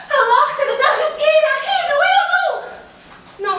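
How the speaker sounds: high, loud, hurried then stretched out; frantic demand. Acted. Patient demanding whether the doctor is coming, frantic and high. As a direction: shout impatiently for an answer.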